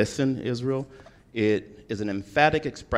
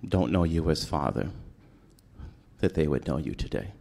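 quiet prayer; 3 semitones lower, 4 dB quieter than usual. Real, not acted. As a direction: pray quietly and humbly before speaking. Pastor prays before sermon.

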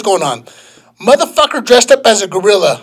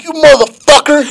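higher, louder retold yell at him; mock yell; 7 semitones higher, 4 dB louder than usual. Gorilla guy charging at him, mock yell. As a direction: bark it like the attacker did. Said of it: "He was like".

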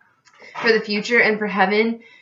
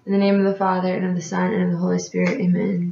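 prayer; 3 semitones lower, faster than usual. Real, not acted. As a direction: pray it steadily and sincerely. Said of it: "you should probably pray".

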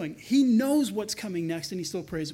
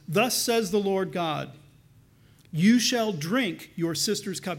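slow, weighty and a bit louder; slow solemn reading of the prophet; solemn scripture reading; 3 dB louder, slower than usual. Acted. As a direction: proclaim the prophet's words. Reads Ezekiel aloud.

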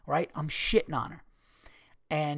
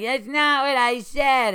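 high, loud, stretched-out heckler whine; mock indignant protest; 9 semitones higher, 7 dB louder, slower than usual. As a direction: imitate an outraged heckler objecting. Retells heckler protesting the riff.

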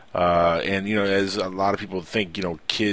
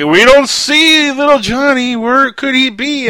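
much higher, louder and slow, sing-song pretend search; mock clueless adult; 12 dB louder, slower than usual. Mocking adults who play hide and seek, a high loud slow sing-song. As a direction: ham up a grown-up faking that they can't find the kid.

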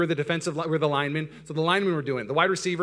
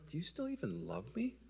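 much softer, a pouty little plea; fishing for reassurance from his wife; husband asking his wife on the plane, small and needy; 5 semitones higher, 12 dB quieter than usual. Retold or imitated. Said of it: "and I'm like".

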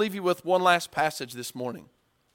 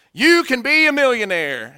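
"You can be a millionaire." belted higher and louder, hammed-up seminar hype; loud pitchman; 8 semitones higher, 9 dB louder than usual. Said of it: "that was his spiel".

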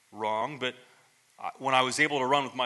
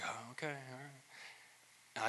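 slow, soft, dismissive brush-off; dismissive shrug; 19 dB quieter, much slower than usual. Retold or imitated. Past self brushing off a friend's climate posts, dismissive and very soft. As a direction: wave it off like it doesn't matter. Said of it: "I'd be like".

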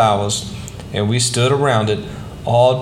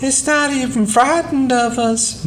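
high, marvelling mother voice; a mother amazed at a tame bird; wondering mother; 13 semitones higher than usual. A narrator's reading. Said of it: "Mama said".

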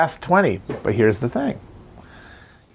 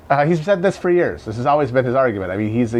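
ordinary talk, nothing marked

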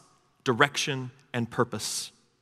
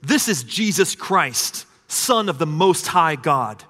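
slow, emphatic preaching; proclaiming; 4 semitones higher, 4 dB louder, slower than usual. Acted. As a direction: announce it slowly like a herald.

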